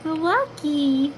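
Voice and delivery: high bright call to the dog. Real, not acted. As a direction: call the dog by name, excited. Greeting the dogs, calling out.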